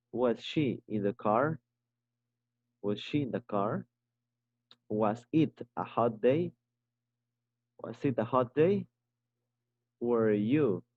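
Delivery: each question read slowly with a clear rise at the end; slow drill reading, lifting every question at the end. Shown as a demonstration. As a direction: model the rising question tune for the class. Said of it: "También tienes que leerlo de esa manera".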